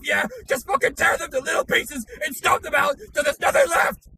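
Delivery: shouting, swearing tirade into the camera; all-out screaming fury at the camera; furious rant. Acted. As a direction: explode with rage at the VTubers.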